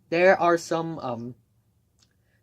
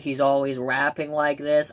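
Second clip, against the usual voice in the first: imitating a nasal rapper; lightly nasal mock rap voice. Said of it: "He has this really nasal voice".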